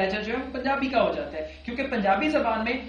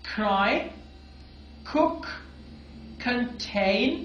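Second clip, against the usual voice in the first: clear drill words; much slower than usual. Shown as a demonstration. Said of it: "मेरे साथ repeat कीजिएगा".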